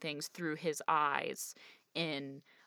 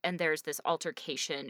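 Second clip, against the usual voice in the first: ordinary delivery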